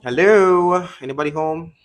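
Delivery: high, drawn-out hello into the silence; calling out. Real, not acted. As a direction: call out to see if anyone is there.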